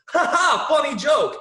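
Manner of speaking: high, loud forced laughter and praise; hammed-up fake reaction; fake hearty laugh. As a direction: overdo the amusement at a joke. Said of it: "I don't wanna go".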